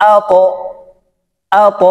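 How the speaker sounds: "আ ত" with the ত pushed upward; upward qalqala. Shown as a demonstration. Teacher repeating "আ ত" for practice, upward qalqala. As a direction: give the ত in "আ ত" a rounded bounce. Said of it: "উপরের দিকে ধাবিত করে".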